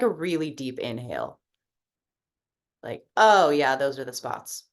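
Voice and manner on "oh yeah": ordinary talk, nothing marked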